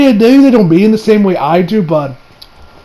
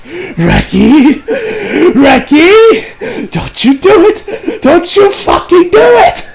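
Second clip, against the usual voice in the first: high, drawn-out comic squeal; mock-panicked warning; 11 semitones higher, much slower than usual. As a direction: plead and forbid, pitch climbing. Acted warning to author.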